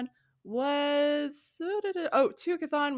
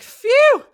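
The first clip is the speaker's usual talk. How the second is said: loud, high mock sigh of relief; relieved phew; 13 semitones higher, 16 dB louder than usual. Villagers finding the evil king dead, loudly relieved. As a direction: blow out an exaggerated, high "phew".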